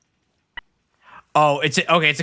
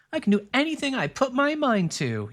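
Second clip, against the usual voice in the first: high-pitched game heroine impression; Agnia; 10 semitones higher than usual. Streamer hearing a line in a game heroine's voice, high imitation. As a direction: put on a much higher character voice. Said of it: "Agnia from Octopath Travelers two, two's voice".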